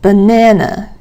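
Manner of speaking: "Banana" with the middle syllable stressed and lengthened; second-syllable stress. Shown as a demonstration. Teacher giving a stress example, second-syllable stress. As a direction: lean on the NAN in "Banana". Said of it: "has the second syllable stressed".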